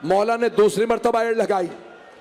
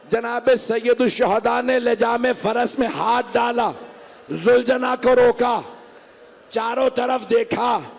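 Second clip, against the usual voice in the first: preaching at full force; 4 semitones higher, slower than usual. Acted. Preacher describing Hussain's fall, slow building lament. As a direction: build the lament slowly toward the chant.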